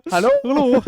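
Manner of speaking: put-on high, loud German-accented hello. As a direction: echo the German accent greeting. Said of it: "that's a German accent".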